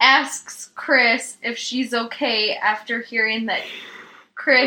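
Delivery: every word spelled out carefully; over enunciating. Acted. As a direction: enunciate each name precisely. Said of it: "enunciating every word".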